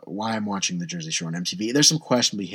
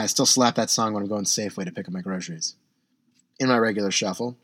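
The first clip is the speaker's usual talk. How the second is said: ordinary talk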